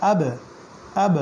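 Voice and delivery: আব with the "b" pushed out into a brief bounce; pushed echo. Shown as a demonstration. Teacher drills qalqala, pushed double sound on আব. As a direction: press and release the end of আব, "ab-e". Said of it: "ধাক্কা দিতে হবে".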